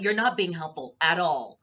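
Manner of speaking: acted loud, annoyed reproach; loud telling-off. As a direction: scold a stranger loudly.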